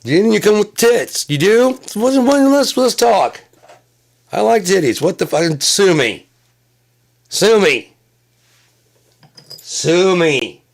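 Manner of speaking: loud, high-pitched comic rant; high shouting. Acted. Comic shouts defiantly at the crowd, high and loud. Comic riffs about liking women.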